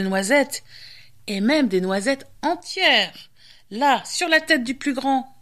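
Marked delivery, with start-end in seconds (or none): none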